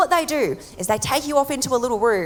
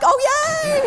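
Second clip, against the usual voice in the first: high squeal of fake delight; high gleeful mock cheer; mock joy. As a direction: flip to sudden glee. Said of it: "I'm like".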